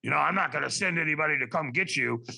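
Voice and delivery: lightly voiced tough smoker; a hint of the smoker who screens callers; mildly imitated no-nonsense phone volunteer; Madge. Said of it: "she talked like that because she probably smoked".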